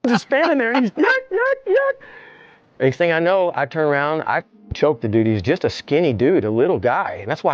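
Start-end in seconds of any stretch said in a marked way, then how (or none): 0.9-1.9 very high mocking cackle; a strangled high yuck-yuck laugh; mock cackling laugh. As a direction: ape the attacker's creepy laugh. Mimicking attacker's laughter.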